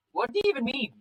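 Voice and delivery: acted defensive outburst; indignant protest. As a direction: throw it back at a parent, pitch jumping. Child argues back at Dad.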